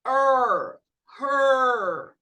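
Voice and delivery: "Er, her" loud with a long curled r; loud model "Er, her"; r-colored vowel. Shown as a demonstration. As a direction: hold the r through "her". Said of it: "tem que falar igual o paulista".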